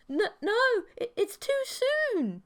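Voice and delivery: high, stammering and slowed; a nervous guy dodging his girlfriend's advances; flustered stammer. Acted. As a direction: trip over the words in a high panic. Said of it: "growing instantly flustered".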